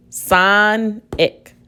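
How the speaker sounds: "Sonic" as son, pause, ick; syllable by syllable. Shown as a demonstration. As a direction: stretch the first syllable of "Sonic", then set the second apart. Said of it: "The first syllable is son".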